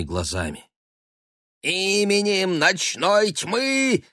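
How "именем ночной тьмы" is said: high, slow commanding shout; shouted incantation. Acted. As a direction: cry it out like a dark wizard. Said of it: "крикнул он".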